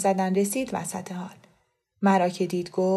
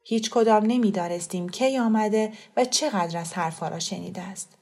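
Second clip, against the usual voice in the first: ordinary delivery, nothing marked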